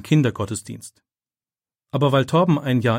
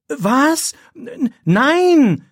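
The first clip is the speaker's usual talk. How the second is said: high, frightened outburst; boy accused of cheating, crying out high; shocked cry; 6 dB louder, much slower than usual. A narrator's reading. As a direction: gasp it out in horror. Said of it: "Philipp erschrickt".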